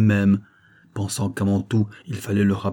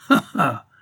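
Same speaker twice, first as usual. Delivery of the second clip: acted hearty "ha, ha"; narrator's performed laugh; 4 semitones higher, 4 dB louder than usual. A narrator's reading. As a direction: laugh out at his foolishness. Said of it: "continuai-je en riant".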